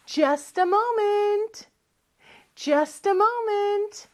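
high, drawn-out call back; too busy to help. Acted. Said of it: "너무너무 바쁜가 봐요".

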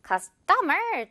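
CUSTOMER as cus-TO-mer, middle drawn out; wrong middle stress. Shown as a demonstration. Teacher showing a mistake to avoid, "customer" stressed on TO. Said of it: "중간이나 마지막 음절에 강세 주면".

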